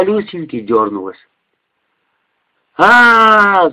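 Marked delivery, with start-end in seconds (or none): 2.8-3.7 high, loud scream; scream of pain. Acted. Girl screams as glass hits her face. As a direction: cry out in sudden pain. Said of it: "закричала Люсенька".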